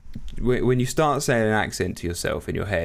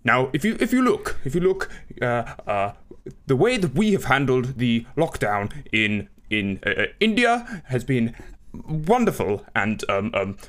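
stammering Prime Minister, pitch leaping on key words; Boris Johnson; 3 semitones higher than usual. Acted. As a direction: bumble and hesitate like a pompous leader. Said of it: "do your fucking Boris Johnson accent".

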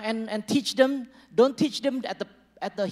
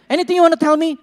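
higher, louder wife's question; wife asking; 8 semitones higher, 11 dB louder, much faster than usual. Retold or imitated. Pastor voicing a wife asking her husband, higher and louder. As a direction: ask brightly like a wife. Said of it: "You know".

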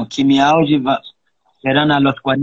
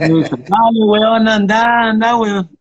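Chilean mom; 6 semitones higher, much slower than usual. Retold or imitated. Plays Chilean mother's encouragement.

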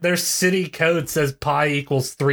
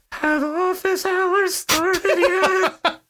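high, very slow creaking skeleton voice; much slower than usual. Acted. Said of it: "and in a creaking voice".

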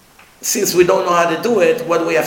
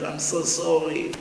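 soft mock crying; tearful apology; 8 dB quieter than usual. Rabbi apologizing to the bird, imitated crying. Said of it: "Like this, crying".

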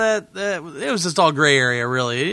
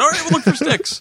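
placating; 12 semitones higher than usual. Retold or imitated. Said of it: "So I just said".

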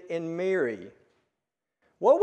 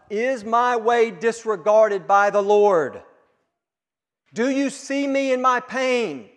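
raised, louder plea to God, higher; crying out; 5 semitones higher, 8 dB louder than usual. Retold or imitated. Preacher voicing Israel crying out to God, raised plea. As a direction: cry out to God from the pain. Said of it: "when Israel cries out".

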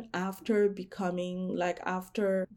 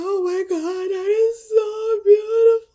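high, loud, tearful gushing; mock-sobbing over how beautiful it is; gushing teary voice; 13 semitones higher, 11 dB louder than usual. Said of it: "I was just like".

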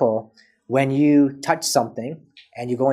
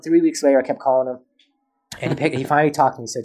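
ordinary talk, nothing marked

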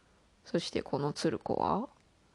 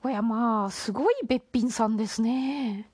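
higher, amazed granny voice; higher old woman voice; 6 semitones higher, 5 dB louder than usual. Acted. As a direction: gasp it like a delighted granny. Grandmother amazed at the girl.

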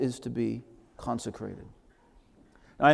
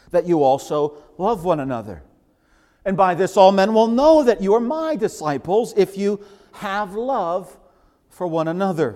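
louder and higher, slowing on have love; forceful scripture quote; 7 semitones higher, 8 dB louder than usual. Acted. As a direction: proclaim the commandment. Quoting Jesus with force.

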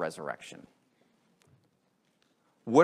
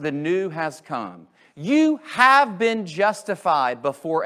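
emphatic preaching; 4 semitones higher, 6 dB louder than usual. Acted. Pastor declaring Paul's promises to the congregation, emphatic and loud.

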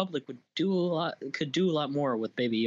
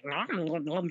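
greedy "nom nom" gobbling noises; imitated munching; 3 semitones higher than usual. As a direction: imitate a little creature chomping away. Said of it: "He's like".

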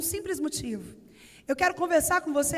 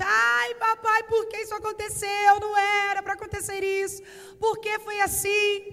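put-on crying boy; 5 semitones higher than usual. Boy cries to father after the butterfly dies, wailing. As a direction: cry out like a heartbroken small boy. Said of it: "começa a chorar desesperadamente".

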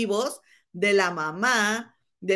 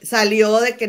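usual speaking voice

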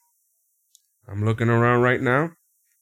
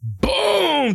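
excited boom; 14 semitones higher than usual. Retold or imitated. Narrating surprise attack.

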